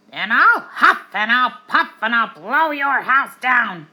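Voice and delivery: very loud, slow pig roar; big bad pig threat. A narrator's reading. Pig threatening the wolves, loud slow roar. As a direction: bellow the threat slowly.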